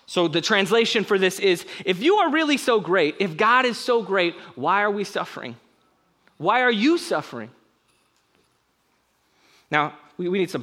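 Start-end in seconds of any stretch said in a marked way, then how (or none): 1.8-7.5 louder, higher challenging voice; preacher voicing the doubter's taunt; mock taunt. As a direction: throw the challenge back at God. Paraphrasing the criminal's taunt.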